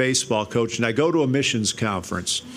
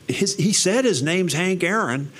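imitating wife; 6 semitones higher than usual. Wife voiced in phone story.

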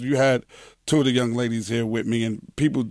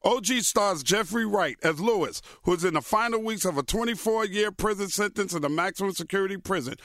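smooth sponsor ad read; 6 semitones higher, 4 dB quieter than usual. Acted. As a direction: read it like a host ad.

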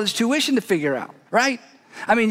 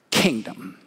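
ordinary talk, nothing marked

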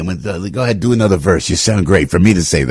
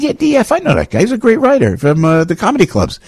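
higher, bright-pitched retold reply; lightly voiced eager self; 10 semitones higher than usual. Host retelling his own reply to a fan after church, higher pitched. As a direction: replay your own eager answer pitched up.

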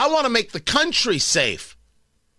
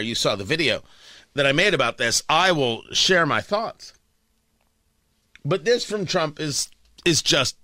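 ordinary delivery

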